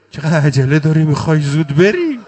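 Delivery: loud, high weeping cry; wailing lament. Acted. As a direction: cry out to him in grief. Zeynab voiced lamenting farewell.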